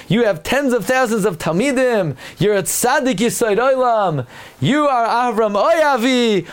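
high, grand calling voice, stretching the name; high, ceremonious divine call; voice of God. Acted. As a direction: call out to Avraham with lofty praise. God addresses Avraham, voiced.

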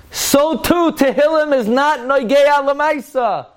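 high-pitched, drawn-out proclamation; emphatic declaration. Real, not acted. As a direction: drive the point home, slow and very high. Emphatically driving home a provocative conclusion.

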